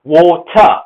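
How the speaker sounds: British "water", R dropped; water with a British O and sounded T; British pronunciation. Shown as a demonstration. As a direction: say "water" as waw-tuh. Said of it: "not going to bother pronouncing that letter".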